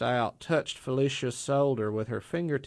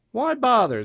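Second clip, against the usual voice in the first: higher, louder biting snap; angry retort; 12 semitones higher, 7 dB louder than usual. A narrator's reading. Zora snaps back at jealous Frida, angry. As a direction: bite the words off in anger. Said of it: "flush with anger".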